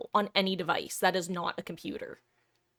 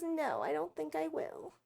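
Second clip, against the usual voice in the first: voicing the pet; 6 semitones higher, 7 dB quieter than usual. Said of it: "She says".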